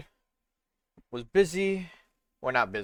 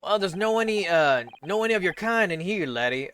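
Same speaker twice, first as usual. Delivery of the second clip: lightly put-on country accent, higher at the start; 6 semitones higher than usual. Governor turns away the visitors, in a light country accent. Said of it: "I was giving him a country one".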